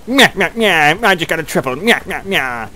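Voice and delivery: put-on nasal childish nyah-nyah taunt; mock a gloating player in sing-song. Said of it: "Could you imagine if somebody actually sounded like that?".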